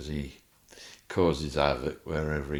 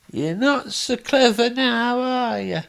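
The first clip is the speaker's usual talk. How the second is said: much higher, louder, slow mocking taunt; teasing Joe; 9 dB louder than usual. Friend Joe teasing him in the hospital bed. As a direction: taunt him in a silly high voice. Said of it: "And he's going".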